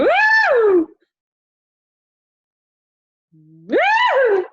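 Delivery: very high, loud woo; high whooping glide; voice thrown high. Shown as a demonstration. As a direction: swoop the voice up high. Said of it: "throw my voice up in the air".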